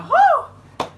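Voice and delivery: high, loud delighted whoop; mock thrilled yelp; excited whoop. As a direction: let out a giddy holler. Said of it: "I'd be like".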